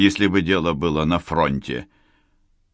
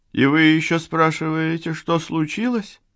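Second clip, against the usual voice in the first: higher, incredulous woman's voice; indignant retort; 10 semitones higher than usual. Acted. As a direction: throw the question back at him, outraged.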